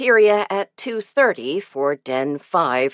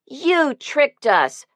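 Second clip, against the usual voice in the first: higher, slow reproach; a boy's slow, higher reproach at being tricked; reproachful boy; 9 semitones higher, much slower than usual. A narrator's reading. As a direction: drag it out like a boy who's been had. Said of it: "Toby reproached her".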